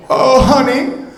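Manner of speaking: high, sing-song mother's voice; mock mom voice. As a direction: answer the little one like a chirpy mom. Mother answering the child.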